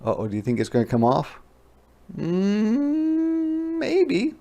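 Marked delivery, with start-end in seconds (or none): 2.2-4.3 wondering maybe. Acted. Teller answers as wondering.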